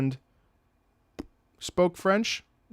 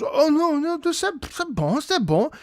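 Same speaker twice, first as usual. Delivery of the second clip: higher, easy c'est bon; reassuring driver; 13 semitones higher than usual. Retold or imitated. My driver settling it, higher and easy. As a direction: wave it off lightly in French. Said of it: "he goes".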